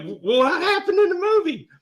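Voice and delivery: put-on high, loud child voice; curious little kid. Small boy pressing his mother, high and curious. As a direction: ask like a persistent small boy. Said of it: "I was just a little kid".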